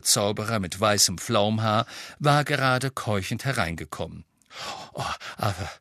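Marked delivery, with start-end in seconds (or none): 4.9-5.7 desperate. A narrator's reading. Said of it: "sagte er verzweifelt".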